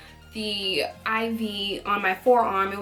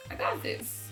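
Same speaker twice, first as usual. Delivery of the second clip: whispered pep talk; 12 semitones higher, 10 dB quieter than usual. Retold or imitated. Psyching herself up before vomiting.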